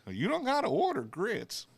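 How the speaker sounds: mock Southern waitress, squeaky and emphatic; Southern waitress punchline. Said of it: "lesson learned".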